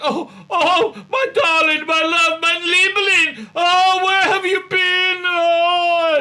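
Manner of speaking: high, loud, drawn-out swooning; gushing lover. Retold or imitated. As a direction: overdo the lovesick reunion, slowing down. Jens gushing over the Doctor.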